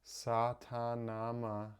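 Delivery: Satanama said slowly, each syllable clear; Satanama, sa-ta-na-ma, slow and even. Shown as a demonstration. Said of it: "So the first one is".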